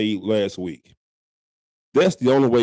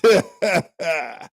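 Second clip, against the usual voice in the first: loud laugh; 5 semitones higher, 3 dB louder than usual. Real, not acted.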